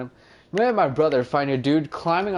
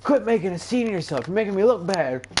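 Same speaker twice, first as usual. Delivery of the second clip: higher scolding stepmom voice, hammed up; imitating stepmom; 7 semitones higher than usual. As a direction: nag like a stepmother fed up in public. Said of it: "she's like".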